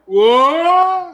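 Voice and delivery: very loud, high mock shock; shocked shout. Man hearing of two million dollars, shocked yell. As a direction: yell it in stunned disbelief.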